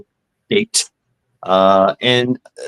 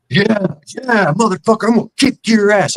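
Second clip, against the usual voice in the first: put-on higher, brash drunken yell; mock bully accepting a fight, higher; 8 semitones higher than usual. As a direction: snarl it like a cocky drunk.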